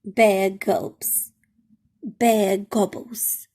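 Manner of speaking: ordinary delivery, nothing marked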